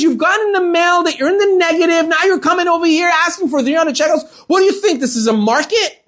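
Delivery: high-pitched outraged tirade, going nuts; retold angry shouting. Teller scolding an overdrawn customer in public, shouting. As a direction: act out a teller losing it on a customer. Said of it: "starts shouting".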